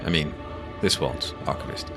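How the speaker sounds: plain reading voice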